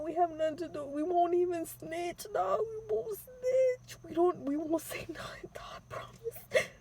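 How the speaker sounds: high pleading, fading into a whisper; terrified begging. Retold or imitated. Begging the gunmen not to kill them, high whispered pleading. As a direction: promise anything to stay alive, voice high and breaking. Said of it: "I kept saying".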